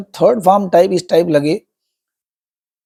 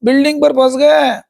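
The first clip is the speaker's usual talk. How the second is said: mock wail; 6 semitones higher than usual. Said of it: "जोर से चिल्लाई".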